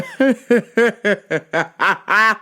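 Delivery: long real giggle building up; laughing. Real, not acted. Recalling the grumpy man's first appointment, laughing. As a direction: crack up mid-story. Laughs while telling the story.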